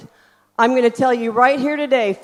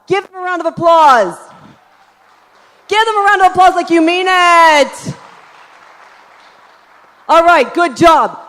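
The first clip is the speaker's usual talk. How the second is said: calling for applause; 5 semitones higher, 8 dB louder than usual. Real, not acted. Leading audience applause.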